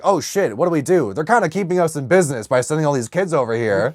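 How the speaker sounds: ordinary talk, nothing marked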